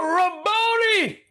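high, drawn-out cry; understated outcry in scripture reading. A narrator's reading. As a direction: call out the name, stretching it high. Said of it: "and cried out".

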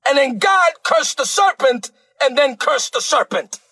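ordinary talk

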